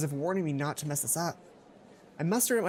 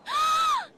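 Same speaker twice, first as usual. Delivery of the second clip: high, loud delighted gasp; excited gasp; 5 dB louder than usual. Acted. Mai thrilled by invitation.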